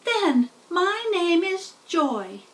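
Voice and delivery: high, slow tiny voice; name herself proudly; little spider voice. A narrator's reading. First spider names herself to Wilbur, high and slow. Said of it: "said the first spider".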